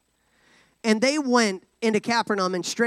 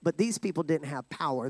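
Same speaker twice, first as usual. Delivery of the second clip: ordinary delivery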